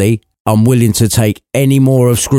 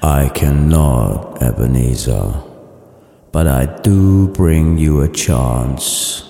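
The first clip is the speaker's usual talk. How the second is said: low, slow spectral boom, sinking deeper at the end; ghostly voice; 5 semitones lower, 3 dB quieter, much slower than usual. Acted. Marley's ghost telling Scrooge of spirits, deep slow voice.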